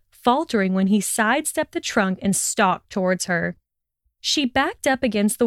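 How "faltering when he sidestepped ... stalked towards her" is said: ordinary delivery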